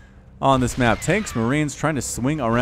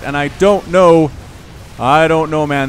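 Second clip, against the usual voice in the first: excited; 3 semitones higher, 8 dB louder, slower than usual. Real, not acted. Caster stunned by the fight.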